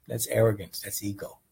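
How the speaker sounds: normal talking voice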